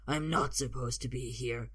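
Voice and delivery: put-on low echo of the kid's words; low, threatening character voice; menacing fighter. A narrator's reading. Fighter echoes the boy's words.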